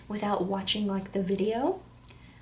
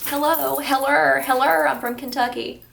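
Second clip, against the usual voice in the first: loud, higher hammed-up country greeting; mock hillbilly hello; 3 semitones higher, 8 dB louder than usual. Vlogger mocking herself as a Kentucky hillbilly. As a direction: ham it up like a Kentucky bumpkin.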